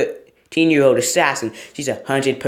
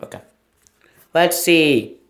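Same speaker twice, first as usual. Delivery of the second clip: ordinary delivery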